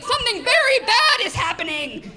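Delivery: high, urgent mock alarm; panicked mom. Mom bursting in on her daughter, high mock alarm. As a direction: sound the alarm like a frantic mother. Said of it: "she was like".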